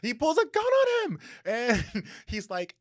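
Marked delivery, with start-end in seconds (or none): 0.0-1.2 very high, loud dramatic squeal; hyped-up outburst. Real, not acted. Podcaster replaying the gun twist for her co-host, hyped-up outburst. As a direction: shriek the shocking plot twist. Hyped repeat after co-host's reaction.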